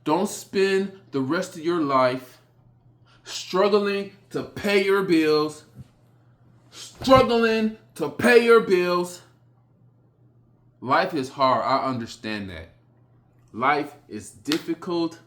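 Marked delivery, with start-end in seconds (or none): 3.2-9.2 slow and louder, building each time; rising shout. Real, not acted. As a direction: hammer the words home slowly. Motivational rant, rising to a shout.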